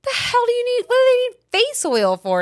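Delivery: high, loud disbelieving squawk; incredulous sister. Retold or imitated. Sister reacting to the face oil.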